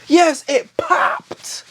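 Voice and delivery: drawn-out, slow mock crying; mock sob. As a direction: sob out the words slowly, hammed-up. Retelling a sad confession.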